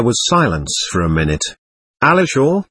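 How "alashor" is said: ordinary delivery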